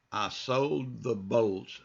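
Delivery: very slow, clear sentence for repeating; slow model read. Shown as a demonstration. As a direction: read it slowly for learners. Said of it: "just follow me".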